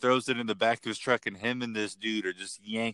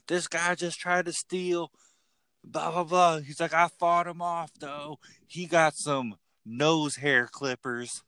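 higher, excited retelling of the store clerk; imitating the coworker; 7 semitones higher, slower than usual. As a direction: play the worked-up clerk, pitched up.

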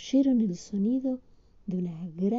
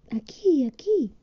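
higher, louder call into the night; 7 semitones higher, 4 dB louder, slower than usual. Acted. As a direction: call out to the sky. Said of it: "gritó Winnie".

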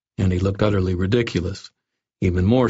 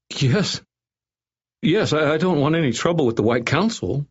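anxious, hurried assent; 8 semitones higher than usual. A narrator's reading. Threatened doctor begs to live.

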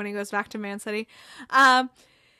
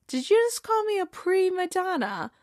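indignant Jamie; 8 semitones higher than usual. Retold or imitated. Retold Jamie, indignant at Roy.

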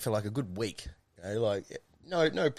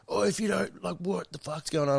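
higher mock neighbour voice; imitating angry neighbour; 7 semitones higher than usual. Neighbour confronting him at the door, higher mock voice. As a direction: play the cranky neighbour, pitched up. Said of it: "He's like".

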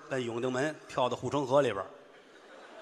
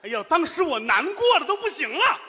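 mock heartbroken wail; 6 dB louder than usual. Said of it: "你这是难过吗？".

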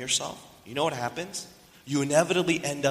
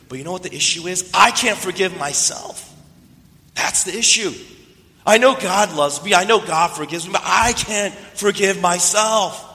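exasperated insistence; 6 semitones higher, 6 dB louder than usual. Retold or imitated. Friend confessing to his pastor what torments him, exasperated insistence. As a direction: insist in anguish, leaning hard on each point.

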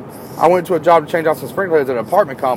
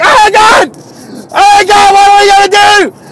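much higher, louder mock panic; panicked shout; 8 dB louder than usual. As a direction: scream like water's everywhere. Boss panics, sprinkler sprays water.

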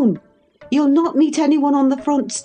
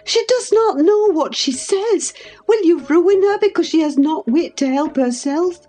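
high, frantic scolding; passionate reproach; 12 semitones higher than usual. A narrator's reading. Housekeeper berates the lover who endangers them all, passionate. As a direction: berate him urgently, pitched far up throughout. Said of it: "I said passionately".

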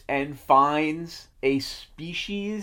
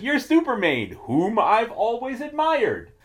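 smitten Jimmy; 10 semitones higher than usual. Retold or imitated. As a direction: gush like a lovestruck fan. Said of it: "And Jimmy then says".

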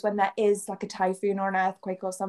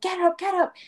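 high urgent calling out; retold panicked get up; urgent wake-up; 8 semitones higher than usual. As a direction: shake him awake in a panic. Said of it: "I was like".

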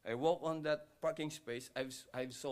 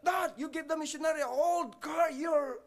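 high, loud acted shout; shouting at dad; 8 dB louder than usual. Son shouting to his dad over the missionary's car, indignant yell. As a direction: yell in outraged protest, voice far up. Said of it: "He shouted to his dad".

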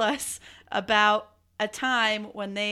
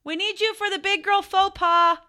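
high, raised summons; pitched up to carry across the house; calling out; 8 semitones higher, 4 dB louder than usual. Real, not acted. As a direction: call someone over from another room. Calling Chris over to join.

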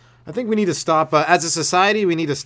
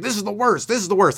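imitated complainer; 6 semitones higher than usual. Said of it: "that guy thought, he was like".

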